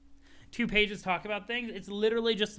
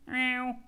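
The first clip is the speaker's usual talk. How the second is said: cat meow; 6 semitones higher than usual. Acted. Cat meows.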